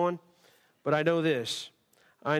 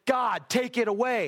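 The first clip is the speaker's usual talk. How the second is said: high, raised pleading prayer; raised plea to the Lord; 6 semitones higher than usual. Acted. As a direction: plead with God for relief. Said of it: "ever pleaded with the Lord".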